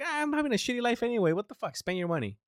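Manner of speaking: high, fast whiny mock complaint; mock complainer. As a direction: mimic a lazy complainer. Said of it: "I hear those complaints like".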